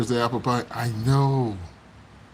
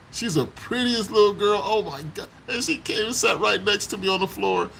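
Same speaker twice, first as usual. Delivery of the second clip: ordinary talk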